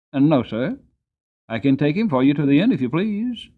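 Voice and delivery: loud, quick, eager to please; a dealer offering service; obliging horse dealer. Acted. Seller replies.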